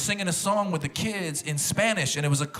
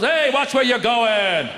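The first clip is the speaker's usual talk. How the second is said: rude American; 6 semitones higher, 6 dB louder, much slower than usual. Retold or imitated. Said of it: "You step on an American toes".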